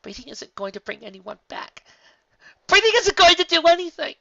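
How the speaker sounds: screaming in despair. A narrator's reading. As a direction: Twilight rages at Cadance. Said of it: "Twilight was screaming".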